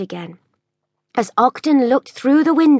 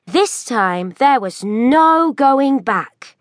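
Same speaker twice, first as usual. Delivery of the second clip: usual speaking voice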